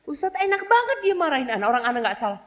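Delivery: high, loud mock complaint from a wife; wife griping to the ustaz about her husband, high and indignant. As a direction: play the wife protesting the husband is unfair, with a laugh.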